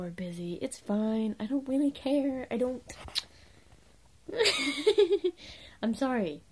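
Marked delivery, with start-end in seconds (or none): 4.3-5.5 giggly laugh. Real, not acted. Laughs at own awkwardness.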